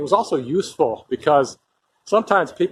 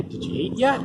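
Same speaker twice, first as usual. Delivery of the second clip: Did you eat yet? with the pitch climbing high on yet; rising question tune; 8 semitones higher, 3 dB quieter than usual. Shown as a demonstration. Showing viewers how English tone marks a question, rising. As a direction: let the end of yet sweep up as a question. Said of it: "the tone went up".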